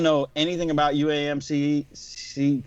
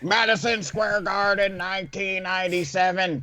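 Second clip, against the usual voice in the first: wrestling manager promo; 3 semitones higher than usual. Retold or imitated. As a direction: brag it like a ring manager. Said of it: "do your best Ron Musgrave and wrestling manager promotion".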